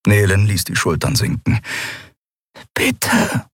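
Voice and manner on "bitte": high whispered plea; small, unvoiced begging. A narrator's reading. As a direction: whisper it pleadingly. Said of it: "flüsterte sie".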